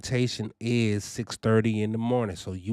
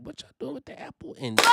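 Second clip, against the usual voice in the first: amazed; 13 semitones higher, 4 dB quieter, faster than usual. Real, not acted. Amazed by the free juice.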